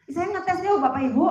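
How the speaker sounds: laughing while calling out to the crowd. Real, not acted. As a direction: chuckle through the words. Preacher testing crowd, laughing.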